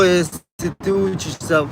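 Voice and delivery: usual speaking voice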